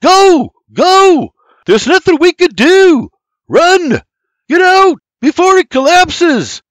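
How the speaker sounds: high, loud frantic commands; furious full-voice yells to get moving; infuriated shouting. Acted. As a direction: scream at them to flee.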